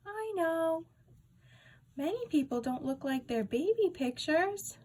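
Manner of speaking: a hint of a cheerful older lady; lightly voiced secretary.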